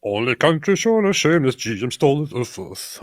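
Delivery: rereading the garbled line in a put-on foreign accent; mock broken English. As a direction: sound it out like the clumsy commenter. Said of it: "What's that mean?".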